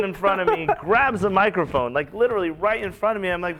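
ordinary talk

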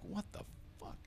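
breathy incredulous whisper; whispered what the fuck, aside to himself; whispered disbelief. Retold or imitated. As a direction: hiss it under your breath. Muttered in disbelief.

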